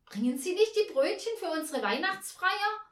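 high, loud horrified question; appalled. A narrator's reading. Hostess to supposed caterer about the party, appalled. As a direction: ask in shrill dismay. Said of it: "sagte sie entsetzt".

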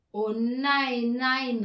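loud, appalled cry; horrified cry. Acted. Father sees the long wish list, loud and horrified. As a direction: cry out in dismay. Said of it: "rief Herr Timpe entsetzt".

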